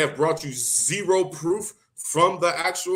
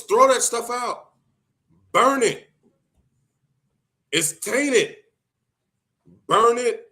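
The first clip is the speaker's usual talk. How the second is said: louder, higher orders to burn the books; raised urgent commands; 5 semitones higher, 3 dB louder than usual. Real, not acted. To followers about Salafi books, loud urgent commands. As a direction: push each command out strong.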